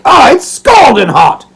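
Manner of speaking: loud, high happy roar; loud delight. Acted. Old father roaring happily over hot food. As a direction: shout it out with joy. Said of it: "roared Toby".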